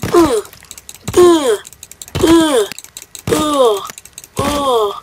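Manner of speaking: short high grunts; effort grunts. Pretend. Gamer grunting as his character swings at an enemy. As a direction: grunt with each blow. Grunting while hitting in game.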